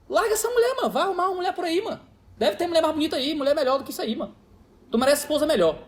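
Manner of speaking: egging him on to test him; provocative urging. Retold or imitated. Pastor provoking man in story. (provocação aguda e brincalhona)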